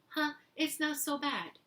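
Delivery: high-pitched pleasant realisation; mild surprise. Retold or imitated. Acting out his own later reaction.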